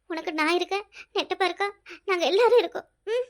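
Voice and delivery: ordinary talk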